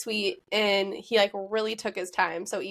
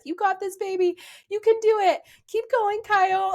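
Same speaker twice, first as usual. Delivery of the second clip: much higher and louder, cheering; imitating cheering mom; 10 semitones higher, 5 dB louder than usual. Mother-in-law cheering groom through his vows, high loud cheer. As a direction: cheer him on like a mom in the crowd. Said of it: "just cheering".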